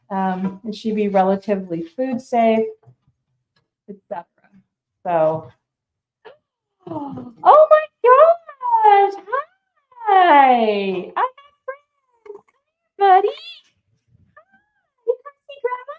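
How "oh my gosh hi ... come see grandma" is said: very high, drawn-out squeal of joy; high-pitched, gushing grandma greeting; delighted greeting. Real, not acted. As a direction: squeal hello to a beloved grandchild.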